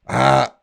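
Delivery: short loud, high "ah"; sudden yell to test the mic; test shout. Shown as a demonstration. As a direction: bark a quick "ah" into the microphone.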